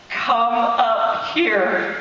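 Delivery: drawn-out, grave command; slow solemn divine call. Retold or imitated. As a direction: voice of God calling her up, slow and solemn. Voice of God in prophecy.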